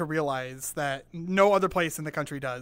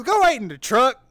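put-on thick Southern accent; 5 semitones higher, 7 dB louder than usual. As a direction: order someone off in a mock Southern twang. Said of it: "his southern accent had a southern accent".

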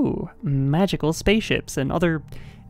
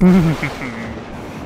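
evil laugh; 3 semitones lower than usual. Pretend. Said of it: "the Foxy evil voice".

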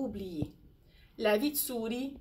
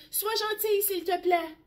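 much higher, whimpering plea; a scared lion begging not to be hurt; frightened whimper; 8 semitones higher than usual. Acted. Lion whimpering to the mouse, higher and frightened.

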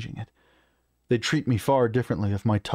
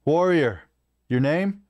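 raised, higher-pitched challenge; commanding query, voice lifted; calling to warrior; 7 semitones higher, 4 dB louder, slower than usual. A narrator's reading. Narrator calls out to orc.

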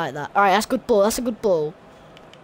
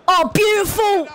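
excited shout; 7 semitones higher, 6 dB louder than usual. Real, not acted. Cheering a good move in game.

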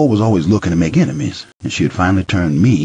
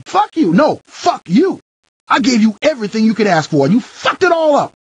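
much higher, louder shrieking shout; screaming fury; 3 dB louder than usual. Acted. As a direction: scream it at him, all out. Wife screaming in a fight.

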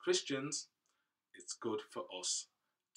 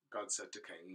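plain reading voice

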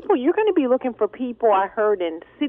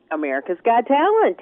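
ordinary delivery

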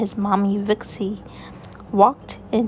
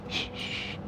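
hushing; 13 dB quieter than usual. Acted. Hushing the baby.

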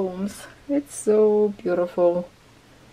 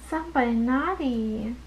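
usual speaking voice

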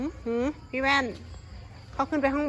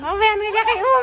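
excited; 8 semitones higher, 6 dB louder than usual. Real, not acted. Coaxing dog to be held.

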